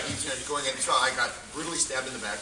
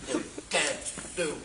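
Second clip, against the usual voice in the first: normal talking voice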